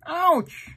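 high squeaky pained ouch; mock ouch. Uncle voicing the hurt dog, high playful ouch. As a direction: cry out like a hurt puppy. Sheep falls.

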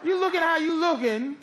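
usual speaking voice

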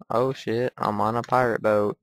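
normal talking voice

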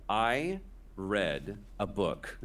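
very slow, each word weighted; slow reveal. Shown as a demonstration. Confessing to the host, slow dramatic pause. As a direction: drag it out for the big reveal. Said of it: "the true story is".

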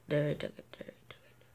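whispered repeat; voiceless disappointed whisper. Real, not acted. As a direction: drop to a dejected whisper.

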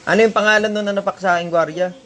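loud, high and fast call; calling out again. Real, not acted. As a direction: shout it again so she hears. Repeating the call, faster. (malakas at mabilis na pagtawag)

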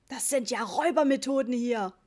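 loud outraged dad; angry, forceful outburst; roaring indignation. A narrator's reading. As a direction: bellow it in protest at the swindle. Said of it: "brüllte Papa".

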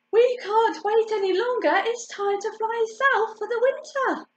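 calling out. A narrator's reading. Mother duck calls missing duckling.

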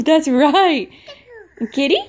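ordinary delivery

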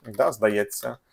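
ordinary delivery

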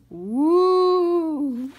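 long, loud, high spooky ooh; ghostly wail. Acted. Brother voicing the cave with a loud spooky wail. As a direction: moan like a haunted cave.

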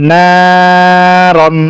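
very slow, level, sustained first vowel in "নারন"; stretched madd. Shown as a demonstration. Teacher showing students madd, "না" drawn out, "রন" short. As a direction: hold "না" long in "নারন", then a quick "রন". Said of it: "নাকে খালি টানলাম".

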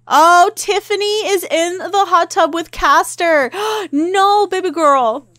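high, loud, gasping dismay; scandalized outburst. Real, not acted. Player spots Tiffany in the hot tub with Castor, scandalized. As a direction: cry out in dramatic disbelief.

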